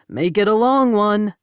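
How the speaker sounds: a playful call to the departing daughter; calling after. A narrator's reading. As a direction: call it after her as she leaves. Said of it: "Jake called after her".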